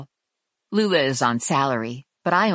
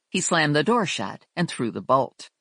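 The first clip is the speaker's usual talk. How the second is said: plain reading voice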